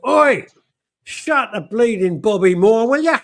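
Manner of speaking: high, loud cockney holler; mock yell. Rocking Dave telling diners to shut the door, high mock yell. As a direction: bellow it at the door, hammed-up. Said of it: "he goes".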